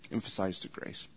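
very soft, almost whispered; hushed repeat. Real, not acted. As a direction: drop to a near whisper to let it sink in. Preacher softly repeats his key line.

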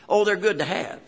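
usual speaking voice

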